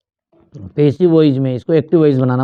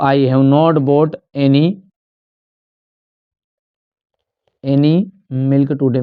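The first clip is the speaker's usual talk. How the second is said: slow dictation; much slower than usual. Shown as a demonstration. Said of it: "dictating the answer slowly".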